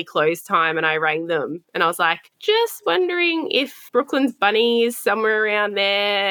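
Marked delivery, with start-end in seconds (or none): 2.4-6.3 overly sweet, high, casual phone voice; fake calm. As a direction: sound breezy and unbothered. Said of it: "I don't reckon you were that calm".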